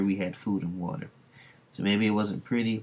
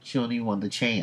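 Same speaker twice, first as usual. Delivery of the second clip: usual speaking voice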